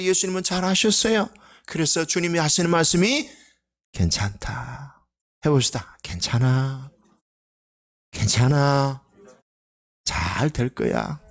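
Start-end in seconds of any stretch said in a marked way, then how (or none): 3.9-5.1 slow, softer reassurance; gentle comfort. Acted. Jesus reassuring weak people, slow gentle comfort. 6.0-11.2 slow, lower soothing reassurance. Shown as a demonstration. Pastor showing the congregation how to comfort, slow and gentle.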